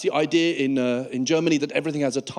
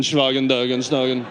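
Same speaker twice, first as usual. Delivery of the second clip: mock German; 3 semitones lower than usual. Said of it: "Stupid English man".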